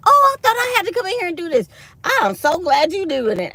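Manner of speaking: high, loud, fast gush of the coworker. Retold or imitated. Coworker relieved she doesn't have to decorate, high loud gush. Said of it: "she said".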